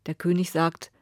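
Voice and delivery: ordinary delivery, nothing marked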